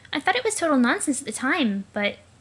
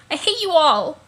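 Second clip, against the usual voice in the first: fed-up outburst; 4 semitones higher, 6 dB louder than usual. Acted.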